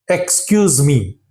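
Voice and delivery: "excuse me" with the opening "ek" fully sounded; learner's unclipped "excuse me"; full pronunciation. Shown as a demonstration. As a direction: keep the first syllable "ek" in "excuse me". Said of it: "We normally produce the sound like".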